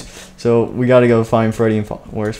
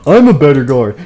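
louder, play-acted insistence; insistent; 2 semitones higher, 6 dB louder than usual. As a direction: assert it loudly, like a bickering guard. Guard insists in acted argument.

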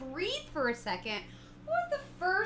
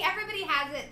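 usual speaking voice

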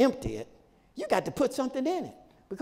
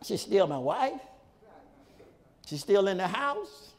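usual speaking voice

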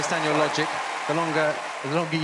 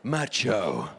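low, slow butch voice; deep macho voice; 8 semitones lower, slower than usual. Shown as a demonstration. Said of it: "we've gotta find you a voice".

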